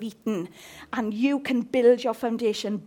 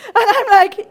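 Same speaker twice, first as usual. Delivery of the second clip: loud comic build-up; 9 semitones higher, 13 dB louder than usual. Real, not acted.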